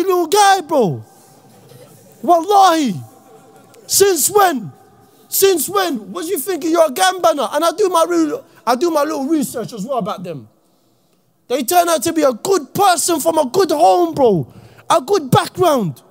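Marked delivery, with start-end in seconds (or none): none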